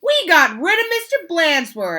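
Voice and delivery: put-on high, loud gleeful kids. Class gloating about getting rid of the principal, loud and high. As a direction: crow it like kids set free. Class cheering in read-aloud story.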